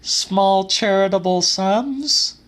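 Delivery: put-on young woman's voice. A narrator's reading. Celia voiced calmly.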